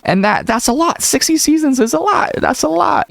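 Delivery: high, amazed squeak; squeaky disbelief. Real, not acted. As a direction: voice jumps way up in disbelief. Disbelief at sixty seasons.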